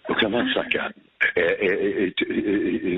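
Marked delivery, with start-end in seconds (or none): none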